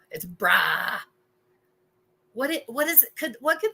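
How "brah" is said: "bruh" with an open ah. Shown as a demonstration. Confirming how they say the slang, "bruh" with open ah. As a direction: say "bruh" as brah. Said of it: "that's how they say it".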